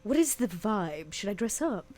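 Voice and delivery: usual speaking voice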